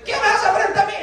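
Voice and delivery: burlándose de sí mismo, con voz mucho más aguda y riendo; desafío chillón en broma. Retold or imitated. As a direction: imita a un Goliat pequeño, agudo y ridículo. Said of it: "Imagínate a mí". (pregunta aguda y risueña de un gigante de broma)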